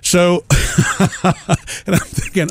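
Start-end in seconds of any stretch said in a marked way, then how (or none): 0.5-2.5 soft laugh through the words. Real, not acted. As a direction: laugh it out quietly. Laughs at absurd situation.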